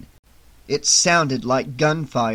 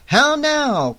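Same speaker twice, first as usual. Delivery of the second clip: loud cry; 11 semitones higher, 4 dB louder than usual. A narrator's reading. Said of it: "cried Arthur".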